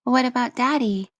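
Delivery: put-on high, bright child asking; a small girl wondering aloud; little girl voice. Child voice Lilica.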